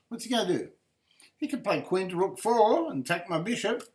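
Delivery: normal talking voice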